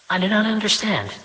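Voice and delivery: high, bewildered mother to her son; puzzled mother. Acted. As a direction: sound lost and prim.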